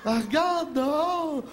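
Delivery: very slow, drawn-out urging; hammed-up slow nagging neighbor; slow mock neighbor. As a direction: stretch each word like a pushy seatmate. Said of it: "le gars, il va".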